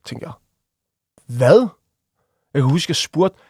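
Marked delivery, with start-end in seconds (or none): 1.4-1.7 loud, rising startled question; re-enacted disbelief. Defendant coming to in court at the charge, loud bewildered disbelief. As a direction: blurt it as if just woken.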